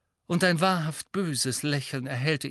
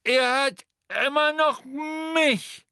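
high, loud and slow defiant call; 13 semitones higher, 5 dB louder, slower than usual. A narrator's reading. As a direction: shout it down from above, much higher. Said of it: "rief eine Stimme von oben her". (laut und langsam von oben gerufen, viel höher)

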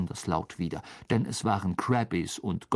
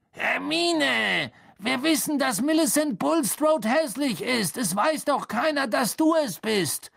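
put-on high, raised boy figure; Harry coaxing impatiently; 13 semitones higher than usual. A narrator's reading. Harry urges Hermine to come out, high and insistent. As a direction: plead through a door, pitch well up.

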